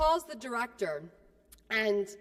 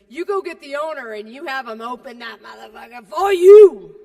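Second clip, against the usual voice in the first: much louder fiery yell; Cesar Chavez shout; 18 dB louder than usual. Retold or imitated. Quoting a labour leader, a loud fiery shout. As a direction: blast the punchline like a rally cry. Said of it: "as Cesar Chavez said".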